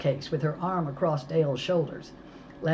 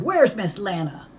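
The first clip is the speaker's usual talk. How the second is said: higher and louder shout; 6 semitones higher, 6 dB louder than usual. A narrator's reading. Mo shouting at the kidnapper. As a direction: yell down the phone. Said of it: "I shouted".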